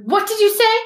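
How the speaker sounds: high, loud, affronted question; spat demand. A narrator's reading. Master demanding an answer from his elf, spitting it out. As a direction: throw the question out sharply, voice shooting up. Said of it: "spat Mister Malfoy".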